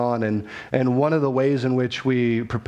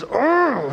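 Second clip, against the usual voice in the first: put-on high, loud kid's groan of frustration; exasperated groan; 13 semitones higher, 3 dB louder than usual. Boy sliding down the couch groaning at mom. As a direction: let out a fed-up nine-year-old's groan. Son throws head back, groans.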